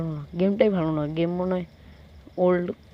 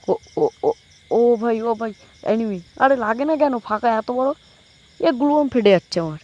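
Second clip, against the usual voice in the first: loud, high, frantic gamer shouts; panicked yelling; 4 semitones higher, 7 dB louder than usual. Real, not acted. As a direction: yell in panic as the enemy appears. Enemy attack, panicked gaming yells. (আতঙ্কে চিৎকার)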